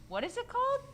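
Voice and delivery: baffled. Retold or imitated. Baffled reply to the director.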